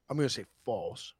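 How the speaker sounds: ordinary talk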